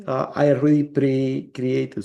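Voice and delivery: ordinary talk, nothing marked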